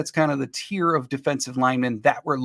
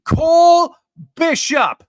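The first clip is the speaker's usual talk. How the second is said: much higher and louder, shouted name; all caps shout; 13 semitones higher, 10 dB louder, much slower than usual. Retold or imitated. Host reading a viewer's all-caps vote, shouted. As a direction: yell it out like it's typed in capitals. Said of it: "making it clear what he wants in all caps".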